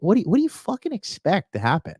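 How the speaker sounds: high-pitched, exasperated rant; exasperated disbelief. Real, not acted. Streamer ranting about reckless couples, exasperated. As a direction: demand it in sheer disbelief. Ranting at both partners' behaviour.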